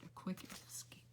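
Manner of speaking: conspiratorial whisper. A narrator's reading. Narrator whispering the escape advice to listeners. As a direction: let it drop into a secret hush.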